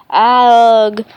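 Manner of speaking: a loud choked grunt when words won't come; strangled ugh. Acted. Tongue-tied boy chokes on his greeting, loud strangled grunt. Max chokes trying to speak.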